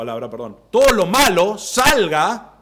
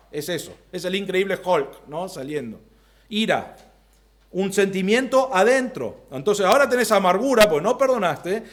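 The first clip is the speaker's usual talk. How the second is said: ordinary talk, nothing marked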